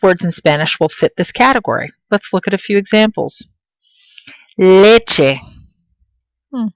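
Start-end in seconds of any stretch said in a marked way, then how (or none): 4.6-5.4 Leche, leaning hard on the LE; louder first syllable in Leche, second let go; stressed first syllable. Shown as a demonstration. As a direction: punch the start of Leche. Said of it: "I hear the stress here".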